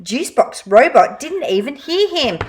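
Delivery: ordinary delivery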